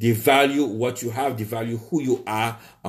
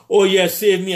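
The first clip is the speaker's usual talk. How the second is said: higher and louder, hammed-up pious plea; mock prayer; 8 semitones higher, 7 dB louder than usual. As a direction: parody a hypocrite begging to be saved. Mocking churchgoers praying.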